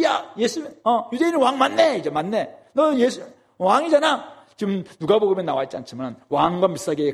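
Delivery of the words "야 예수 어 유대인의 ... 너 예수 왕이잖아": high scornful ridicule of the king; mocking jeer. Soldiers mocking Jesus as king, high jeering. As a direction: sneer it up high, taunting. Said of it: "희롱, 조롱하는 거예요".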